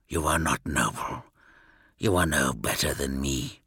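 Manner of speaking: very low, soft mutter. A narrator's reading. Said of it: "he muttered".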